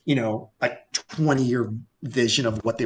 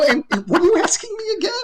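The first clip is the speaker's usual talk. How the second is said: high, quick, stammered question through laughter; laughing disbelief; 4 dB louder, faster than usual. Retold or imitated. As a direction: crack up while asking, voice shooting up. Teller acts out his bafflement.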